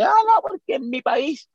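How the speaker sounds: high, drawn-out mock protest; mock complaint. Immigrant protesting to locals about home, mock complaint. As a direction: whine it as a stubborn newcomer. Mocks immigrant who refuses to adapt.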